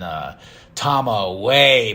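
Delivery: ordinary talk, nothing marked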